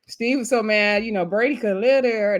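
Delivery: ordinary talk, nothing marked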